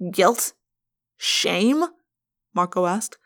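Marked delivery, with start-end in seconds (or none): 0.1-1.8 rising, doubtful "Guilt?" and "Shame?"; incredulous. A narrator's reading. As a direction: question each word as foolish. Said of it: "like she was foolish".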